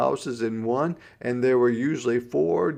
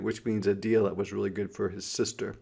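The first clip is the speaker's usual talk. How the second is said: plain reading voice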